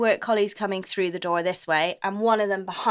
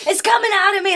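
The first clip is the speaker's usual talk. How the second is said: high, loud mock panic; a lightly voiced movie panic; Bridesmaids quote; 10 semitones higher, 6 dB louder than usual. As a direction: quote the film scene in a panic. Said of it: "one in Bridesmaids when they're like".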